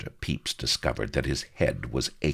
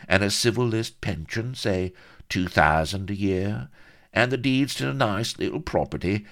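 higher-pitched fixer, at ease; comfortable haggler; 6 semitones higher, 4 dB louder than usual. Acted. Vladimir naming his price to Pepys, comfortably greedy. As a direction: reel off the price with relish.

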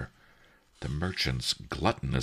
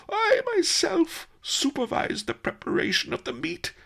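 mock sobbing. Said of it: "Master Li sobbed".

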